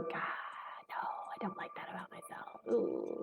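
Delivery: breathy private whisper of regret; whispered to herself. Retold or imitated. She confesses to herself after snapping, soft whisper. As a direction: whisper it like a guilty admission. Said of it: "I go".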